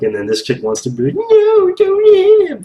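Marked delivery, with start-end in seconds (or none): 1.1-2.6 much higher, louder mocking woman's plea; mocking the woman who pleads not to hit him; shrill mock plea. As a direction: parody her begging him to stop. Mocking female character.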